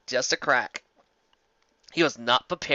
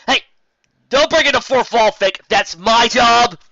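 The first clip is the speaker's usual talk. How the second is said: high, loud, stretched outraged yell; indignant shout; 10 semitones higher, 12 dB louder, slower than usual. Acted. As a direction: protest the fourth-wall break at full voice. Narrator breaks fourth wall.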